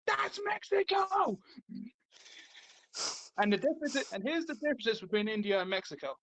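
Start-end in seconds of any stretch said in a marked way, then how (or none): 0.1-1.4 high, drawn-out mock exclamation; comedian's punchline. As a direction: stretch out the punchline high, imitating the comic. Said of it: "He said".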